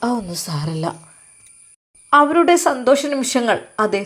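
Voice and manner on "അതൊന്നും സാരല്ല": lower, calm husband reassuring his wife; husband consoling wife, a touch lower; lightly voiced husband. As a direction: tell her gently it's all forgiven. Husband again.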